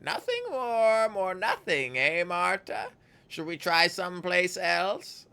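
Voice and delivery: very high, slow sing-song crone; gleeful, creepy crone voice. Acted. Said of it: "in a strange sing-song voice".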